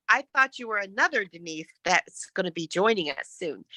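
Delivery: ordinary talk, nothing marked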